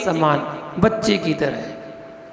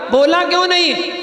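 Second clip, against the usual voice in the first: scolding; 7 semitones higher than usual. Acted. Master scolds the dog.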